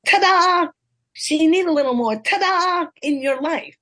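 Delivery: high, loud triumphant "ta-da"; big showman ta-da; husband's ta-da. Retold or imitated. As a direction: announce it like a proud husband showing off. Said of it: "comes in and goes".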